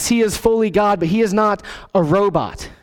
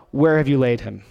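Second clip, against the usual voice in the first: lower, steely and resolved; stony, determined; 6 semitones lower than usual. Shown as a demonstration. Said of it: "with a stony, determined look in his face".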